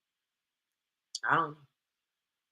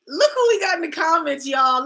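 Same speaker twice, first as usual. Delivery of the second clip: high, loud gleeful call; excited callout; 8 semitones higher, 5 dB louder than usual. Real, not acted. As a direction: point out the commenter with glee. Calling out the commenter again.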